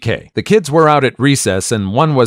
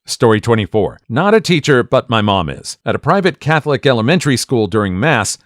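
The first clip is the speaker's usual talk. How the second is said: ordinary delivery, nothing marked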